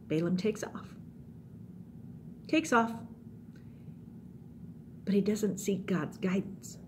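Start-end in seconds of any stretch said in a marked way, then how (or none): none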